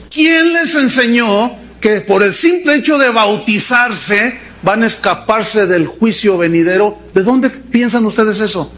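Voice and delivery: ordinary delivery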